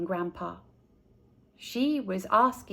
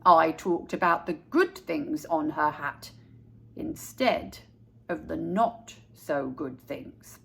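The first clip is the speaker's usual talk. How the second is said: put-on lower, slow old-man voice; a calm grandpa explaining himself to his grandson; gentle grandpa; 5 semitones lower than usual. A narrator's reading. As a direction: settle the boy down like a patient grandfather.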